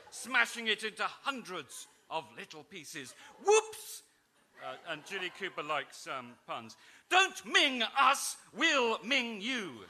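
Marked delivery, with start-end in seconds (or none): 3.4-4.0 squeaky high comic whoops; mock whoops. Narrator voicing the crash, squeaky mock surprise. Vase smash reaction. 7.1-9.9 slow drawn-out lordly pun. Acted. Lord Guy punning to Amelia, slow mock grandeur. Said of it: "said Lord Guy".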